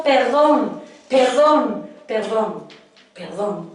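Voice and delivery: tearful plea. Retold or imitated. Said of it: "caemos muchas veces llorando, diciendo". (súplica llorosa que se va apagando)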